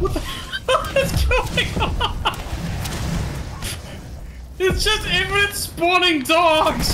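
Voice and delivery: high, slow disbelieving cry; shocked laughing outburst. Real, not acted. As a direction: squeal it out in shock. Swarmed by endless dogs.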